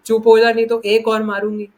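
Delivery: mock-scold like an exasperated mother, loud. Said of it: "तो mummy क्या कहती थी".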